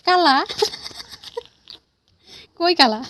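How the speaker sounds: high, loud surprised echo; mock surprise. Real, not acted. Mother echoing her toddler's word in high mock surprise. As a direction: play along amazed.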